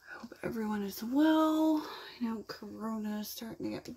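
wipe brushing the lips; talking while wiping face. Real, not acted. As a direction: hand and cloth at mouth. Seen 0: Wipe held on cheek, then brushed against chin and lips.

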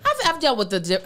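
ordinary delivery

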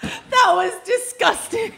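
laughing. Real, not acted. Laughing at opponent's blunder.

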